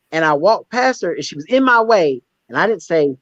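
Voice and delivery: ordinary talk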